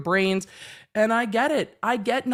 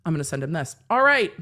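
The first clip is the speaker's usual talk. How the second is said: ordinary talk, nothing marked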